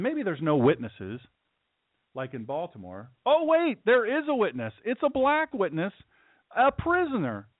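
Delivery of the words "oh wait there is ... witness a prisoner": high-pitched mock astonishment; mock surprise. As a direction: feign sudden amazement, pitched way up. Mock surprise at finding a witness.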